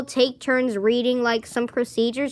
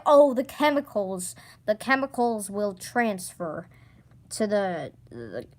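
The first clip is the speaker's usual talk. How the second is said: lightly voiced droning teacher, lower and softer; mock teacher voice; 6 semitones lower, 3 dB quieter than usual. Girl mocking her teacher's water rule, in a low droning voice. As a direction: mimic the boring science teacher's low drawl. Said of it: "He won't let us drink water because, like".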